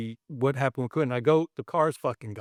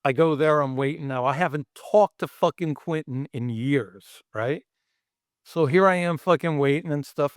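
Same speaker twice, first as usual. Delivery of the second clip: normal talking voice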